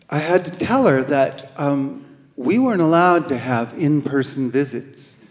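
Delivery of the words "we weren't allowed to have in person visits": usual speaking voice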